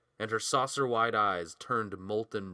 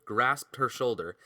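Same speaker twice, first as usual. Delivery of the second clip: plain reading voice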